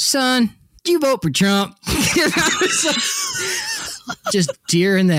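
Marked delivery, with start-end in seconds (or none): 0.0-1.8 put-on old Southern man. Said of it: "and he goes". 1.8-4.2 high burst of laughter; laughing. Real, not acted. Laughing at own story.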